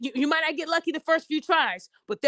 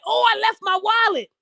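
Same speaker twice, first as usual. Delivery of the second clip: mock woman; 6 semitones higher than usual. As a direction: ham up the helpless line. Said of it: "a woman sitting there like".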